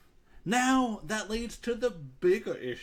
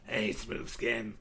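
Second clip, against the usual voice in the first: ghoul voice; 7 semitones lower, 4 dB quieter, slower than usual. Shown as a demonstration. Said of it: "I can do it pretty well".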